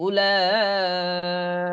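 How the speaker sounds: slow, drawn-out Quran chant; Quranic recitation. Shown as a demonstration. Teacher recites the joined word for the students after spelling. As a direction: recite it slowly and sustain the vowels.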